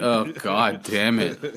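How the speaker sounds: ordinary delivery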